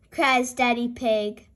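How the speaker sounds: ordinary delivery, nothing marked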